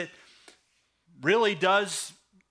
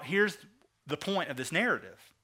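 normal talking voice

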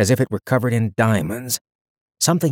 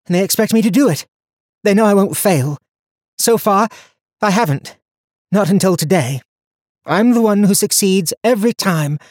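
lightly voiced young heroine, higher; young woman voice; 10 semitones higher, 5 dB louder than usual. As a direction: speak her lines higher and brighter. Donia worries aloud.

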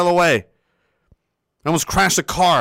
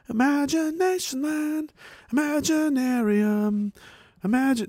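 dreamy mock wonder; 9 semitones higher, 6 dB quieter, slower than usual. Playful riff on the word imagine.

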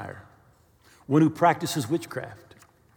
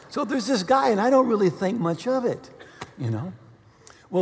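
ordinary talk, nothing marked

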